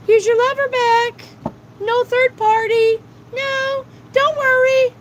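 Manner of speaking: high, loud mocking falsetto; sing-song fake promise from the universe; squeaky mock-reassuring voice; mock sweet voice. Mocking the universe handing back lover.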